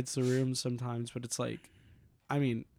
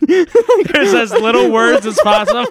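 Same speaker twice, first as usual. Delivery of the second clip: laughing through words; 14 semitones higher, 15 dB louder than usual. Real, not acted. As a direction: talk while cracking up. Laughing at the impression.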